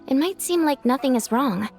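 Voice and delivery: normal talking voice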